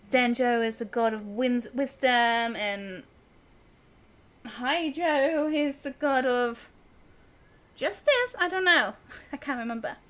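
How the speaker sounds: usual speaking voice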